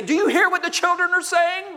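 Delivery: high, loud, accusing question; indignant question. Acted. Preacher voicing angry religious leaders, loud and accusing. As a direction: throw it at Jesus like an offended leader.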